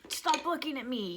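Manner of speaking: high, restrained retold yell; imitated yell. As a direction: imitate an upset child snapping at onlookers. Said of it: "he yells at them".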